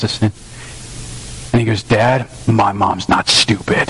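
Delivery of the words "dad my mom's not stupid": imitating his upset son, low and slow; low, deliberate retold indignation, word by word; restrained righteous anger. Son defending his mom to dad, restrained righteous anger. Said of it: "like, righteous anger inside of my son".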